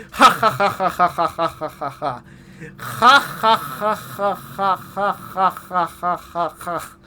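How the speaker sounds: drawn-out mock villain cackle with a Russian accent; evil laugh. Shown as a demonstration. Voicing the Tsar after his letter, a mock evil Russian-accented laugh. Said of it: "let me do that".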